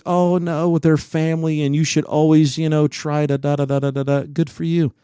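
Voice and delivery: mocking preachy voice. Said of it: "if you're like".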